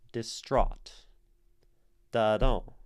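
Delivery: "Distraught" with the weight landing on the second syllable; light first syllable, strong second in "Distraught"; stress on second syllable. Shown as a demonstration. Teacher showing which syllable of distraught is stressed, second-syllable stress. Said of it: "the first is unstressed".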